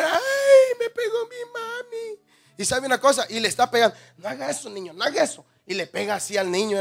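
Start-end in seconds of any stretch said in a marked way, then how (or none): 0.0-2.2 agudo y lento, lloriqueo infantil burlón; llanto fingido de niño. Pretend. Niño llora tras golpe. 4.2-5.4 regaño blando y mucho más bajo; mamá regañando suave. Retold or imitated. Mamá corrige suavemente al niño.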